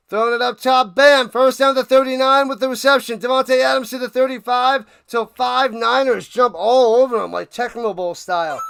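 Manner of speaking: real hyped play-by-play; amped up. Real, not acted. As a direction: ride the adrenaline through the play. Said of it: "I'm getting amped up".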